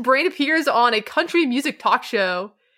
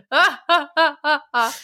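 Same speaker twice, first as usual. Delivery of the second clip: forced, dry stage laugh, a little higher; mock sitcom chuckle; dry fake laugh; 4 semitones higher than usual. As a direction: imitate an awkward canned laugh. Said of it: "dry possible".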